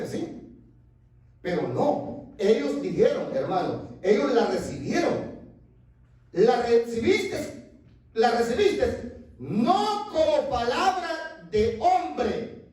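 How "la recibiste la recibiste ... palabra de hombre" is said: slow, weighty reading; slow, solemn preaching of the verse; slow scripture emphasis. Acted. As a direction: read every word deliberately.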